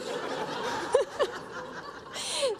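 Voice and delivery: a high, airless burst of laughter; breathy high laugh. Real, not acted. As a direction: laugh high and breathless at your own joke. Laughing at her husband impression.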